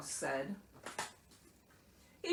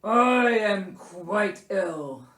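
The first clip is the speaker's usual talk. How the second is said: loud, slow, put-upon bear voice; ailing, indignant Bear; 10 dB louder, much slower than usual. A narrator's reading. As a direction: complain weightily as a sick bear. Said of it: "Bear reminded him".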